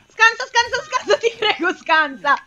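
high, loud, frantic begging; urgent high-pitched plea; panicked pleading. Real, not acted. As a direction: cry it out as the blow comes. Player begs to dodge an attack.

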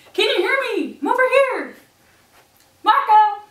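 loud, high call across room. Acted. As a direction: call out to be found. Calling to blind person.

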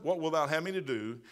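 ordinary talk, nothing marked

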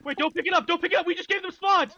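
high panicked shout; yelling from the sidelines. Real, not acted. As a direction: yell the warning fast.

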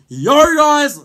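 mock yell of the intro line, high and loud; imitating a shout. Mocking a mate who yelled his intro, loud shout. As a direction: blast the greeting like he did. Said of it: "'cause he shouted".

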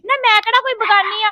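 loud, indignant protest at her sister. Acted. Younger sister loudly protesting to her accusing elder sister. Sister defends herself in quarrel.

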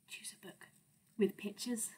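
whispered plea. Acted. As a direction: breathe it out softly.

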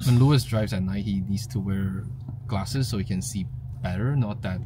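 usual speaking voice

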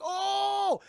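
loud, high mock gasp of horror; hammed-up "oh" of alarm; shocked cry. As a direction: cry out as if seeing a monster. Characters react to giant spider.

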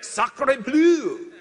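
comic French oath, half laughing; mock French exclamation. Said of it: "I don't know what that means, but I like to say it".

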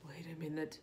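ordinary talk

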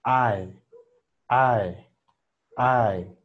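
I said as E then A, ai glide; English I sound. Shown as a demonstration. Teacher drills English I for the class. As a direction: model I gliding from E to A. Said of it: "la I inglesa".

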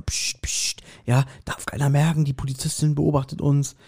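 normal talking voice

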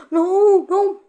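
high, loud gasping no; gasping dismay. Real, not acted. Gamer panicking as the battle turns, gasping no. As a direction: panic as it slips away. Gasps as territory lost.

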